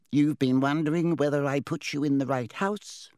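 smart, knowing hat voice; talking hat. Acted. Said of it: "said the hat smartly".

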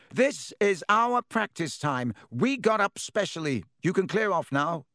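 angry captain. A narrator's reading. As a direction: lay down the law.

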